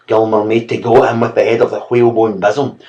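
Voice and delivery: plain reading voice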